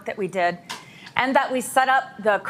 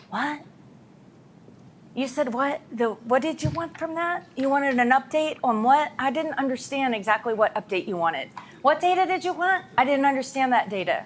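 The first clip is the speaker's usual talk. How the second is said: playing dumb; 4 semitones higher than usual. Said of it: "most people do this".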